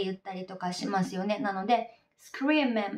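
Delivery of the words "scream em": "scream em" linked, no th in them; "scream em" as scree-mem; dropped th. Shown as a demonstration. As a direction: run scream straight into em. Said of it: "ティーエイチがなくなっていて".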